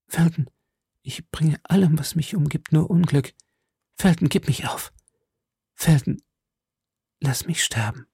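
high, hushed, veiled voice; veiled pleading. A narrator's reading. Lady begging the officer to let her die, veiled pleading. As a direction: plead weakly in a high half-whisper. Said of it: "verschleierte die Augen und die Stimme".

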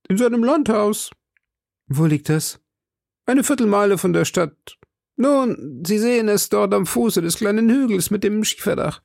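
friendly local giving directions, lightly voiced; helpful townsman. Character answering directions.

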